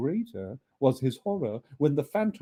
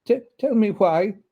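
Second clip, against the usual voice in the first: higher, stammering frightened ask; trembling; 8 semitones higher, 7 dB louder than usual. Acted. Scrooge trembling before the ghost, stammering. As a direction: stutter the question in fear.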